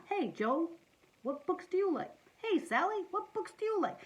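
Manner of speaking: high, inquisitive call to a friend; friendly pretend asking. Reader showing children how to ask friends, high friendly questions.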